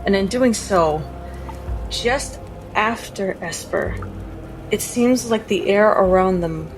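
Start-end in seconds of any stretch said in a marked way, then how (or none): none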